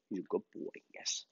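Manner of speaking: hushed, tender praise for the dog; soft dog praise. Real, not acted. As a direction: murmur praise right at his ear. Praising dog softly up close.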